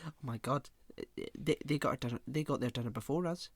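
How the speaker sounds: soft imitation of muttering diners; hushed table-side moaning; discreet grumbling. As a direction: complain under your breath, mock indignant. Diners grumbling before lying to waiters.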